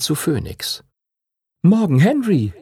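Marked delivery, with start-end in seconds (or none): 1.6-2.5 put-on higher, bright young dragon voice; cheerful greeting. Young dragon cheerfully greets Henry in the morning, higher voice. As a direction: greet your friend gladly. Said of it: "begrüßte der junge Drache ihn fröhlich".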